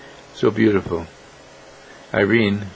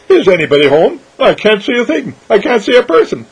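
high, silly clown voice; 9 semitones higher, 4 dB louder than usual. Acted. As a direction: play the fool peeking in. Clown Irene peeking in.

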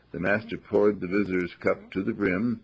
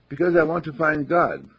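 earnest eagerness; 7 semitones higher, 5 dB louder than usual. Acted. Young man answers eagerly.

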